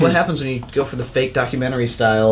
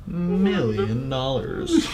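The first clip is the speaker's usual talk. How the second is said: Austin Powers villain; 4 semitones higher, 7 dB quieter, much slower than usual. Retold or imitated. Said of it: "Austin Powers".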